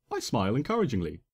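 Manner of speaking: plain reading voice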